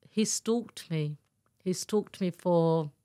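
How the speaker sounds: "he stalked me" drawn out slowly; slow emphasis. Real, not acted. Woman tells the host about her stalker, slow and weighty.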